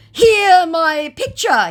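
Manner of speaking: mock proud scammer. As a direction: squeak it as him. Voicing scammer.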